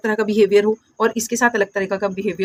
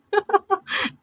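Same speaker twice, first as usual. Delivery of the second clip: short laugh; 10 semitones higher, 4 dB quieter than usual. Real, not acted. Teacher laughs at misreading.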